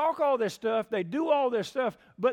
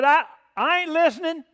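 high, loud heckler's outburst; retold yell; 8 semitones higher, 11 dB louder than usual. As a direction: imitate a cousin yelling out in church. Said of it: "just yelled it out".

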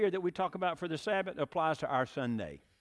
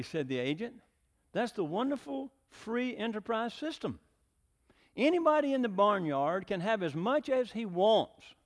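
lively, animated storytelling to the congregation; story reading; 5 semitones higher, 3 dB louder than usual. A narrator's reading. Pastor reads the little red hen fable to the church, animated. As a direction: read it like a children's tale, full of color.

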